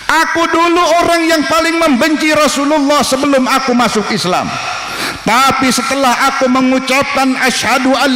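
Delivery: burn with loyalty and anger; fierce Umar. Acted. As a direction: Umar furiously demanding to be whipped instead. Umar fiercely offers himself.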